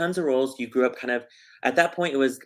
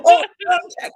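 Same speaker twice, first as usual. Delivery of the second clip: mock British voice; 11 dB louder, faster than usual. Brits mocking Texan accent.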